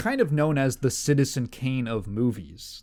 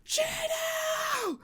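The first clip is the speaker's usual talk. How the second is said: much higher mock shriek of the fans; imitating screaming fans; much slower than usual. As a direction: shriek like a theater full of heartbroken girls. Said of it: "They were like".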